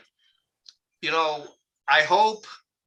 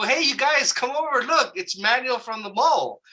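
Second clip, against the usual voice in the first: high-pitched excited call to friends; imitated calling out; 8 semitones higher than usual. Woman calling others over to see Manuel, excited calling voice. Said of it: "she started calling people".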